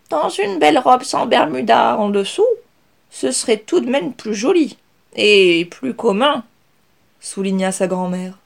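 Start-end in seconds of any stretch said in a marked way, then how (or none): 0.1-6.5 higher, louder grandmother voice, slowing at the end; prim granny, a little vexed; slightly miffed grandmother. Acted. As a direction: grandmother gently scolding about the dress. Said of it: "un rien vexée".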